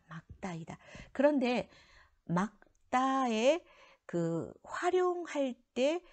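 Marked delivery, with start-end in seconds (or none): 0.1-0.8 softer "막따이다" with ㄱ as the final sound; correct pronunciation of 맑다. Shown as a demonstration. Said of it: "뒤 것을 소리낸다고 했어요". 2.2-3.6 "막따" drawn out slowly, louder and higher; demonstrated pronunciation of 맑다. Shown as a demonstration. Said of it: "뒤 것을 소리낸다고 했어요".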